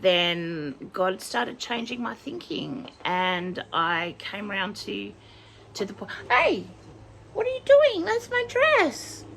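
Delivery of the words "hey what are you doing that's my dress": very high, loud protest; scolding pet. Real, not acted. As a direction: squeal at the pet to leave your dress. Scolds pet for clawing dress.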